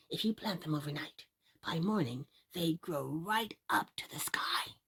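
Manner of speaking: low voice sinking into a whisper; secretive whisper. Acted. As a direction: tell the magic like a secret. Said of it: "said the man".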